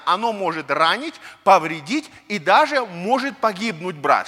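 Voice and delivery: ordinary talk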